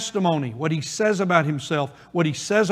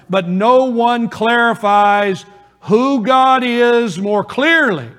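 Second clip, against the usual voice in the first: higher and louder, drawn out for emphasis; slow, loud preaching peak; 6 semitones higher, 6 dB louder, slower than usual. Real, not acted. Pastor to his congregation, a slow, loud, rising proclamation. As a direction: lean into it like the peak of a sermon.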